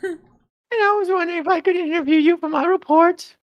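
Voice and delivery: put-on high, sweet little girl voice. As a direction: play an eager schoolgirl asking a favour. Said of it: "make myself look like a little girl".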